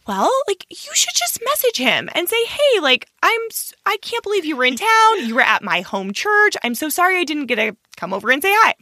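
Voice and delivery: high, bright advising sisters; lightly voiced sister advising. Sisters giving advice.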